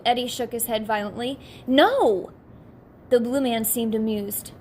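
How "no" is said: loud, firm refusal; emphatic shout. Acted. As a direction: shout the no in protest. Eddie insists, shaking head.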